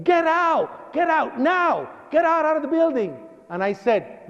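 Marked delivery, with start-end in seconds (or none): 0.0-3.2 high, urgent imitated shouting; retold panicked yelling, pitched up; screaming mother. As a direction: shout orders like a frantic mother. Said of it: "And she's screaming".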